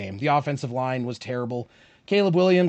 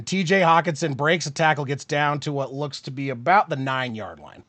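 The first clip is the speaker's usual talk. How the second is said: lively live football call, a bit louder; sportscaster calling the drive; play-by-play; 3 semitones higher, 6 dB louder than usual. Acted.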